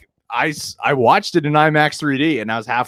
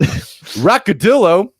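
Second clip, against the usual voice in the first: drawn-out playful name; 8 semitones higher, 6 dB louder, much slower than usual. Pretend. Names the icon playfully.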